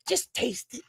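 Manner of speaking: a mother coaxing her picky son; imitating mama.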